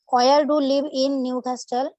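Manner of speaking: ordinary talk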